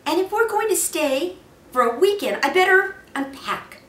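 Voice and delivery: a hint of a busy mother. A narrator's reading. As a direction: lift into a mother's voice.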